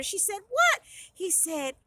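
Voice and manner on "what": high, loud exclaimed question; eager surprise. A narrator's reading. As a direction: pounce on the promised news. Heroine eager for brother's news.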